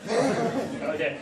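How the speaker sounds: ordinary delivery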